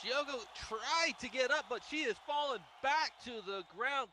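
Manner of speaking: ordinary talk, nothing marked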